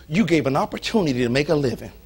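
ordinary delivery

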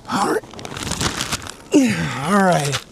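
grunting with effort; straining while talking. Real, not acted. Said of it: "Sorry for the excessive grunting".

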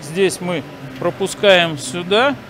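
ordinary talk

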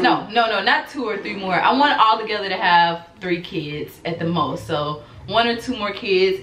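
ordinary delivery

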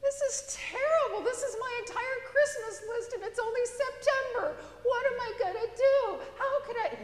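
put-on high, loud, wailing child's voice; little girl wailing in mock despair; whiny little girl. Spoilt daughter wails over toys.

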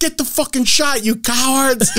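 high-pitched mock heckle; retold heckling cheer. Host heckles reluctant fans about shots, mock cheering. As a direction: goad the crowd in a high, joking yell. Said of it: "I cheered all loud".

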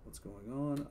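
softly muttered aside. Real, not acted. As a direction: say it under your breath. Streamer pausing for frame drops.

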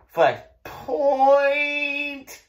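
"point" shouted high with a laugh; laughing high point cue. Shown as a demonstration. Teacher laughing while calling the point move to the class.

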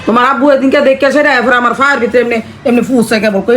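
ordinary talk

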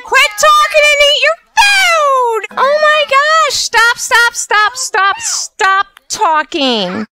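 put-on fed-up parent yelling at chattering kids; shrill exasperated yell. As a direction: shriek at them to shut up.

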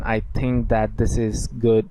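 slow English example. Shown as a demonstration. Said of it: "ইংলিশে, আহ, বলতে পারেন".

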